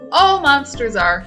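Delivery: ordinary delivery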